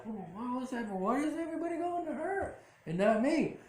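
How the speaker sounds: high, whining imitation of a jealous patient; high mock pout of a neglected woman; mock whiny complaint. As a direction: whine it up high, feeling left out.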